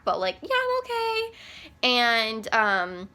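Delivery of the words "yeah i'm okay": high chirpy fake reassurance; faking okay. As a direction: sound fine though clearly not. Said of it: "full on anxiety attack".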